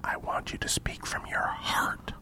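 slow whispered prompt; drawn-out off-mic whisper. Real, not acted. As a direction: coach them slowly in a hushed whisper. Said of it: "You're whispering".

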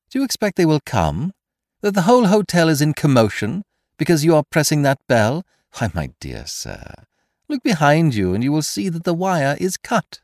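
high, lively mocking questions, dropping for the aside; taunting stranger, amused. A narrator's reading. Stranger taunting Kesselbach at the dead bell, mocking. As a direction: toy with your helpless captive.

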